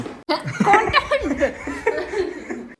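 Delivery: long high laugh, breaking up; big laugh. Real, not acted. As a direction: burst out laughing at the squabble. Loud laugh over fight.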